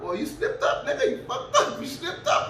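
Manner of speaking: high-pitched mocking cackle through the words; jeering, laughing mimicry; imitating laughing police. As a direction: tease him while cracking up, as the cops. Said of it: "And they laughing".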